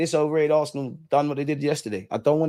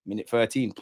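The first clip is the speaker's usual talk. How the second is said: normal talking voice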